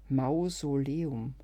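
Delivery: Mausoleum drawn out very slowly; slow realisation. Acted. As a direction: savour Mausoleum as the pun sinks in. Versteht das Wortspiel langsam. (langsam und begreifend)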